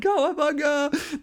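very high, drawn-out surfer whoop; Michelangelo cheer. Retold or imitated. Preacher breaks into a slow, very high surfer whoop. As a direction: stretch out a gleeful surfer whoop. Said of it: "Michelangelo from the Teenage Mutant Ninja Turtles".

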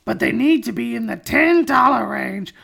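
very high, loud cartoon customer; put-on comic voice. Co-host reading the pet shop customer's budget plea, high put-on voice. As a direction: strain way up high and drag out the words. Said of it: "please don't do that voice".

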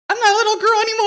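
high, loud, fast sobbing protest; mock wail. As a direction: ham up a crying girl announcing she's grown. Retold wail to dad.

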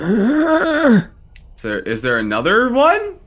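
high startled cry from the dark. Acted. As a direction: jump at the voice, loud and high. Startled prisoner calling from cell.

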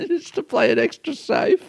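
laughing. Real, not acted. Laughing joke.

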